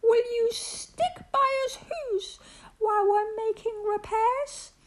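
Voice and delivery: tiny, very high character voice; high-pitched, earnest little plea; pleading little mayor. A narrator's reading. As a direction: squeak out the troubles of a tiny mayor. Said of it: "pleaded that voice of the mayor's".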